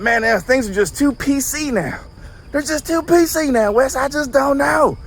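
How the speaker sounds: high-pitched put-on Southern complaint; Southern accent. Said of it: "I'm giving everyone a Southern accent".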